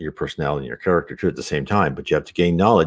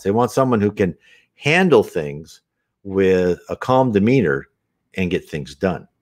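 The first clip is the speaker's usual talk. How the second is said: ordinary delivery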